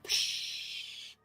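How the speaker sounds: soft, long hissed "psh"; voiceless whoosh of a shot; imitated blast noise. Imitates a shot being fired.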